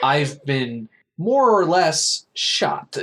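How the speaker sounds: slow, high, drawn-out indignation; playing the affronted victim, slowly; indignant mock reply. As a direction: stretch each word in mock outrage. Acting wounded Angharad's indignant reply.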